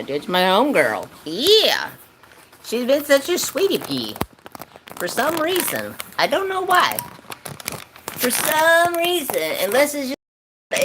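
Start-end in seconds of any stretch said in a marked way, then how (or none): 1.2-10.8 much higher cooing voice. Real, not acted. Streamer praising his cat, high cooing baby talk.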